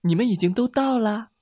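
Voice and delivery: smiling little mouse. A narrator's reading. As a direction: happily reveal it with a smile. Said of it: "小老鼠微笑着说". (小老鼠笑着高声说)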